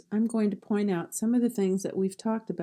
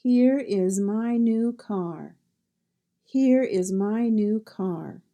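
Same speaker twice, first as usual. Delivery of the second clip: "Here is my new car." said slowly and clearly, stress on Here; slow dictation read; slow model sentence; 4 dB louder, slower than usual. Shown as a demonstration. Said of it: "see if you can figure out the sound".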